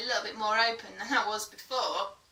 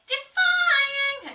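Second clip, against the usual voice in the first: nasal head voice; 9 dB louder than usual. Shown as a demonstration. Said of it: "sort of nasal tone".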